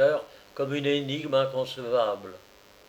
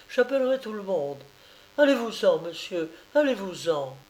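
higher, rising to a cry; frightened refusal; 8 semitones higher than usual. A narrator's reading. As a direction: refuse him, threatening to wake the village. Oulana refuses, threatens to cry out.

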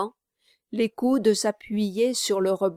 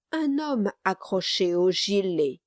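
ordinary talk, nothing marked

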